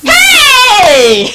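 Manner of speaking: loud high hey; silencing the rival with a loud shout. Acted. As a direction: cut her off with a yell.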